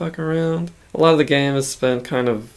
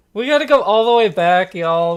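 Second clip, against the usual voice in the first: hyped-up call; 7 semitones higher, 6 dB louder than usual. Pretend. Sudden hype call to go back.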